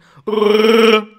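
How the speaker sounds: loud, sustained rolling growl; high, loud imitated beast call; Chewbacca roar. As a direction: trill out a long Wookiee bellow. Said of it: "Do a Chewbacca impression".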